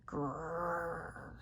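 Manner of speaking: soft growly grr like a little tiger; a small playful tiger growl. A narrator's reading. Ravi still growls a bit.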